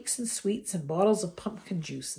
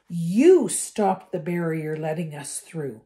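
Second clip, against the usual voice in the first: slow accusing; 8 dB louder than usual. Acted.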